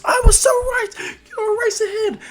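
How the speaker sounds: very high, loud, thrilled shriek; overjoyed fanboy outburst; starstruck fan squeal. Acted. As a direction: squeal like a fan spotting an idol. Fan recognises his hero.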